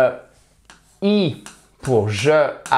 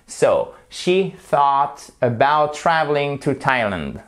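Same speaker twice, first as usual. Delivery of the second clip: slow demo sentence; slower than usual. Shown as a demonstration. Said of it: "On va s'entraîner".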